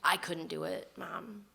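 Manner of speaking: soft, defeated confession; defeated to mom. Retold or imitated. Daughter confessing to her mom, soft and defeated. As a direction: barely say it, beaten. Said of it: "I call my mom".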